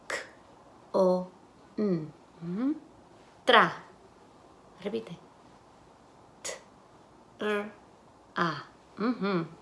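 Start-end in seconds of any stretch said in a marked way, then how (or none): 0.1-2.1 "K, o, n" as isolated sounds with gaps. Shown as a demonstration. Teacher segments con into phonemes, sound by sound. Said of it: "Las fonemas". 6.4-8.6 "T, r, a" as isolated sounds, r rolled alone. Shown as a demonstration. Teacher segments tra into phonemes, sound by sound. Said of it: "Las fonemas".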